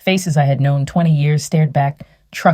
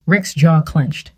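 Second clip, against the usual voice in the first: ordinary delivery, nothing marked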